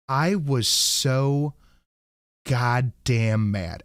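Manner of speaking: drawn out, each word slow and heavy; dragging out how mad he was; slow angry emphasis. Real, not acted. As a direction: stretch it out, still fuming. Venting anger at mechanic.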